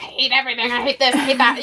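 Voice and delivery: mock grumpy hating; a light imitation of her grumpy self. Retelling her grumpy day to a friend, mildly mock grumpy. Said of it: "I was just so mad and so grumpy".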